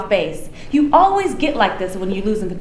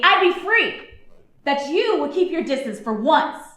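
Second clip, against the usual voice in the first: usual speaking voice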